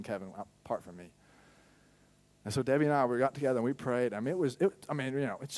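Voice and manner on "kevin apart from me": much softer and faster, almost tender; soft, gentle. Retold or imitated. God gently reminding Kevin, soft and quick. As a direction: drop it to a gentle hush. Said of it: "And God's like".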